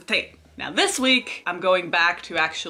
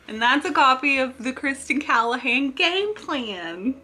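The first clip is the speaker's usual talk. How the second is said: odd put-on delivery; 6 semitones higher than usual. Real, not acted. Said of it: "I don't know why I said it like that".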